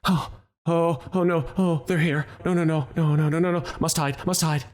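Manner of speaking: a hidden survivor muttering in terror; frightened whimpering voice. A narrator's reading. DM voicing a scared survivor, panicked. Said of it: "The voice in common says".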